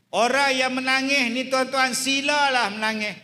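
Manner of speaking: high, loud pleading sermon; impassioned preaching. Acted. Preacher urging listeners to let people cry, loud and high. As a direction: urge them, voice lifted and loud.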